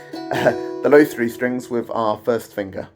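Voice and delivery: normal talking voice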